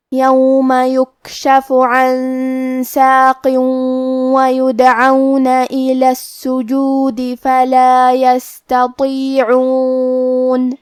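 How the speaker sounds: slow melodic tajweed chant; measured Quranic recitation. Shown as a demonstration. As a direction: recite the verses slowly with long held vowels. Recites the verses.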